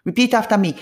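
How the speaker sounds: ordinary talk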